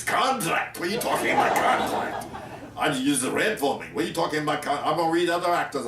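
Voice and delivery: high, incredulous mock outburst; Otto Preminger. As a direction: imitate the outraged director's accent, half laughing. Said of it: "Otto Preminger with his accent".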